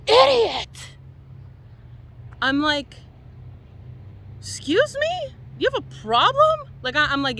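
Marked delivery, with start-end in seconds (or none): 0.1-0.9 sharp, loud high yell of one word; retold angry yell. Angry man yells at speaker. 4.4-6.7 much higher, rising disbelief; indignant retort. Retold or imitated. Speaker retells her indignant reply.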